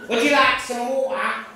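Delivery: Cockney with dropped T sounds; comic Cockney, T's swallowed in "water"; London Cockney accent. Acted. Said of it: "They don't pronounce T sounds like water".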